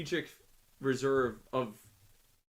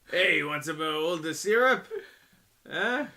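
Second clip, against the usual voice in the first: hammed-up Quebec French accent, louder and higher; 5 semitones higher, 7 dB louder than usual. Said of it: "That's my Quebec accent".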